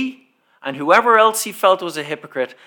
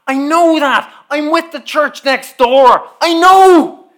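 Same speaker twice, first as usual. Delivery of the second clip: higher and louder, sharp retort; exasperated shout; 10 semitones higher, 7 dB louder, slower than usual. Retold or imitated. Preacher retelling his answer to the car park man, a heated shout. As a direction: snap back at him, fed up.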